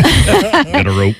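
cowboy voice. Retold or imitated. Quoting old salsa ad joke.

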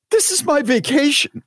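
very high-pitched indignant wail; mock outrage, pitched sky-high; exasperated protest. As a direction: protest as if hard done by, voice way up. Acted quarrel, his protest to her.

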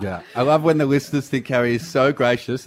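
ordinary delivery

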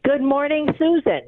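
bright, energetic good morning greeting; wide awake and alert. Real, not acted. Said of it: "you sound like you're wide awake here".

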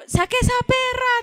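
cita aguda de un video; imitación de video viral. Retold or imitated. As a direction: imitar el reclamo agudo de un video. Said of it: "usted es la del video de". (reclamo agudo imitado)

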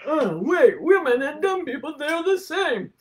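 mild mock condescension; mocking the culture. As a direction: sneer at it lightly. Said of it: "our culture says".

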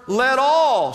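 all leaned on and falling; all held long, then falling; stress on all. Shown as a demonstration. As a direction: lean hard on all. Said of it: "say the word all".

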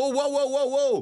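high, laughing whoa; retold startled stop-them call; alarmed protest. Telling the diggers to stop, half laughing. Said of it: "I'm like".

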